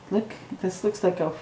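ordinary delivery, nothing marked

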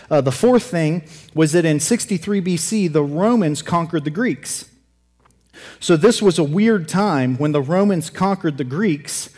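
usual speaking voice

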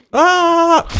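high loud attack yell; battle yell. Real, not acted. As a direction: yell as you strike. Attacking an enemy.

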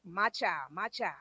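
low, soft, fatherly murmur; tender call. Acted. God calling a straying believer back, tender call.

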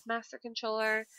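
ordinary talk

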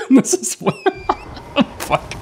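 high, helpless laughing fit; breaking up laughing. Real, not acted. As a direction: squeeze the words out through laughter. Breaks up at enemy behavior.

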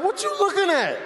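high-pitched indignant challenge; confrontational. Acted. Window passenger to seatmate leaning to look, confrontational. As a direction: challenge them, voice jumping up high.

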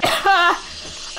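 high burst of laughter; laughing. Real, not acted. Gamer cracking up at own celebration, laughing. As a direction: crack up after cheering.

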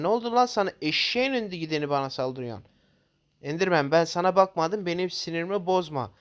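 angry yelling. Real, not acted. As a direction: yell at the mob furiously. Yelling at the attacking mobs.

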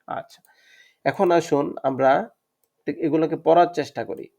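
ordinary talk, nothing marked

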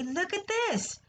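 high exclamation with a falling end; crying out. A narrator's reading. Avery spots the blue tag on the next pen, crying out. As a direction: call attention in a high, excited voice. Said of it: "cried Avery".

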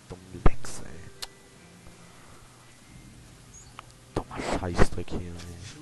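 whispered curses under breath; frustrated whisper. Real, not acted. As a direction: grumble at the lag, whispered. Unvoiced frustrated muttering.

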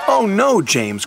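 mild dismay, Thomas cries out; dismayed cry. A narrator's reading. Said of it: "cried Thomas".

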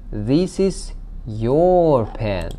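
drawn-out, emphatic YOUR; "your" stretched out slowly, pitch rising on it; stress on YOUR. Shown as a demonstration. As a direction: lean long and hard on "your". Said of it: "has been added for emphasis".

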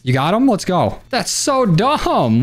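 ordinary talk

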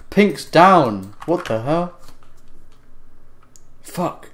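loud, slow disbelief; shocked outburst. Real, not acted. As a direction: blurt it out in shock. Shocked reaction to kill.

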